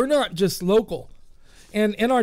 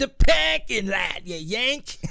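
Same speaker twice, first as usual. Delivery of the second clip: put-on Boston accent; 3 semitones higher, slower than usual. Host retelling getting lost in Boston, mock Boston shout. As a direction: yell it like a Boston local. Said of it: "I was like".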